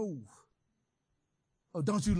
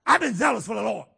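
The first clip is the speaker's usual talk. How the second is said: louder, fast blurted outburst; angry outburst; 4 semitones higher, 10 dB louder, faster than usual. Acted. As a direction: blurt it out with pent-up aggression. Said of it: "lurches out".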